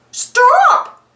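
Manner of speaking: high, loud cry; cry of stop. A narrator's reading. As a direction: shout to stop him in time. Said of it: "Mickey cried".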